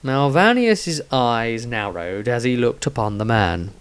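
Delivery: plain reading voice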